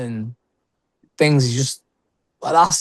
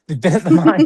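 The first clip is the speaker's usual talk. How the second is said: slightly louder, on the edge of laughter; amused self-correction; 3 semitones higher, 4 dB louder than usual. Real, not acted. Guest redoing the line after swearing on a podcast, amused. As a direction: repeat it through amusement.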